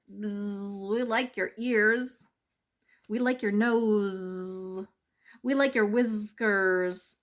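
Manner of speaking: slow, loud sing-song bees; drawn-out happy bee chorus; buzzing bees. Acted. Bees teasing the mouse, slow and loud.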